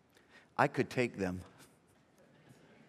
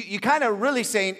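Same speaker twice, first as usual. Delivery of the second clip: normal talking voice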